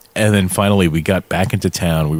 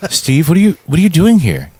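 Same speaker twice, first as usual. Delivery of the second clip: retold surprise; 9 semitones higher, 4 dB louder than usual. Friends' startled question in his story.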